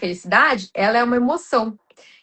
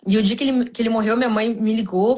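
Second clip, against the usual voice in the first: normal talking voice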